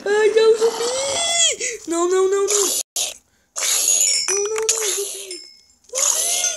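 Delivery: high, loud terrified screams; real frightened yelling at a zombie; panicked screaming. Real, not acted. Kid panics at zombie in game.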